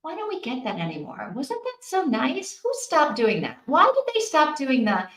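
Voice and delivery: ordinary talk, nothing marked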